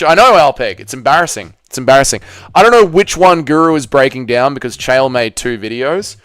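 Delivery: ordinary talk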